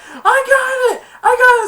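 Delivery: very high, loud yell; excited squeal. Retold or imitated. Jake catching the ball, very high and loud. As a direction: squeal with glee. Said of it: "Jake's like".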